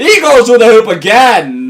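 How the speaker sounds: hammed-up ringmaster shout; mock announcer call. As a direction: call it out like a big stunt. Mock announcing fish through hoop.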